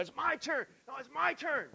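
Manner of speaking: high, small-voiced imitation of bickering boys; squabbling kids. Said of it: "they're yelling at each other".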